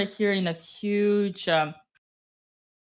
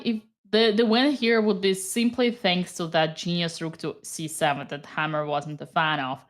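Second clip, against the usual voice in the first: ordinary talk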